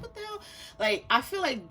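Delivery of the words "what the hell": high, hushed "what the hell" disbelief; small squeaky voice of acted bewilderment; mock bafflement. Rescuer puzzled by someone tripping over nothing, mock bafflement.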